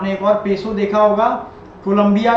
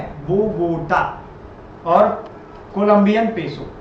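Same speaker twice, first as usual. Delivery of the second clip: slow answer; slower than usual. Shown as a demonstration. Teacher answers own quiz question.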